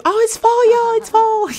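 high, delighted sing-song squeal; excited squeal. Real, not acted. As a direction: squeal it like a thrilled fan. Excited at seasonal game change.

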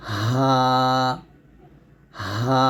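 হা drawn out with air flowing; breathy Ha demonstration. Shown as a demonstration. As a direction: let breath and voice flow through হা. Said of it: "বাতাস জারি থাকবে".

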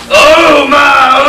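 loud, high gasp of shock; shocked exclamation. Acted. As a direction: react to the mess in alarm. Shocked at the mess.

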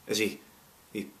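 ordinary delivery

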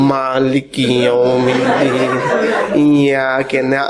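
drawn-out low monotone; droning, stretched prayer chant; very slow recitation. Acted. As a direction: imitate someone reciting painfully slowly. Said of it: "อ่านช้ามากเลย".

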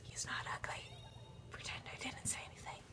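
hushed guilty aside; whisper. Real, not acted. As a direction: whisper it sheepishly. Sheepish aside.

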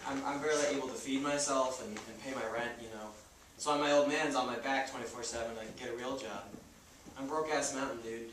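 quiet, low, embarrassed mumble; sheepish admission. Acted. Paul tells the landlord he is broke, quiet and sheepish. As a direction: confess it quietly.

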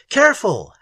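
high, loud warning; warning call. A narrator's reading. Algernon cautioning the driver, calling out warningly. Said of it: "called out warningly".

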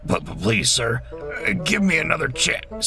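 stammered, rising plea; nervous pleading. A narrator's reading. Bulgy begging the owner for another chance, nervous pleading. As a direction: beg falteringly for mercy.

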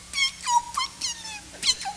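put-on very high, sugary little old lady voice; sugary granny voice. Granny sweetly inviting the groom-to-be inside. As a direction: coo it like a doting granny. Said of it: "Pura azúcar".

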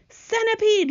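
high, urgent yell of a name. A narrator's reading. James summoning the centipede, yelling. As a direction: call out sharply for help, pitched high. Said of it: "yelled James".

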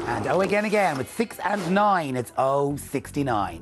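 slow, measured bingo calls; bingo caller. Acted. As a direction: call each number out clearly with pauses.

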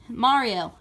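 ordinary talk, nothing marked

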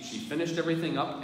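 ordinary talk

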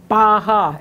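"Baha" loud, lips popping; lip sound. Shown as a demonstration. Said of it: "right up here in the lips".

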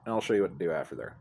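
ordinary talk

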